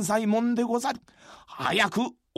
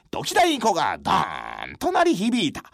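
normal talking voice

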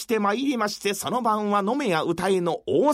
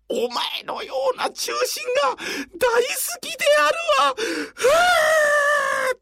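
put-on sobbing old man, high pitched; crying; 11 semitones higher than usual. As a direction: wail it out through tears. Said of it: "いきなり泣き出した".

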